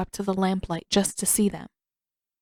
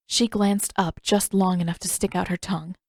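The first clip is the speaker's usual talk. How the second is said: usual speaking voice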